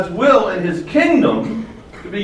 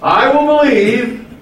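slow, higher, emphatic vow; resolute declaration; 6 semitones higher, 4 dB louder, slower than usual. Acted. Preacher models the believer's pledge for the congregation, resolute declaration. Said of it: "You need to say".

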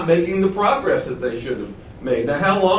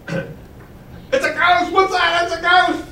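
high-pitched mock terror; comic panic, pitched way up; panicked disciples; 13 semitones higher, slower than usual. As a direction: act out a frightened crew in a high, squealing voice. Acting out the terrified disciples.

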